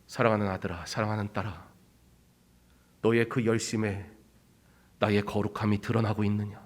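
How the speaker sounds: soft, low, tender question; tender divine question. Retold or imitated. God tenderly questioning his beloved children. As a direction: ask gently like a loving father.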